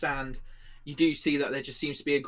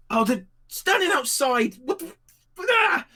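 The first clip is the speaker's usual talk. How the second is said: high, loud yell out to the street; full-voiced, high-pitched outburst; panicked shouting; 14 semitones higher, 8 dB louder than usual. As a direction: holler in alarm, breaking off mid-curse. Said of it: "shouting out the window".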